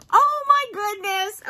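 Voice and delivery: delighted gasp at a crab toy. Real, not acted. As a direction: gasp then a slow, high oh my goodness.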